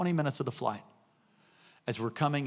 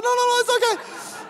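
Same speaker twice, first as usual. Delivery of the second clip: high, louder flustered soothing; mock frantic reassurance; 6 dB louder than usual. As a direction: hurry to stop her crying, hammed-up and high. Hurried reassuring of crying woman.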